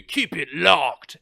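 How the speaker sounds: slow punchy game catchphrase. Pretend. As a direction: deliver it like a hero's line. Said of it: "one of my catchphrases will be".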